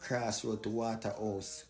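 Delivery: ordinary talk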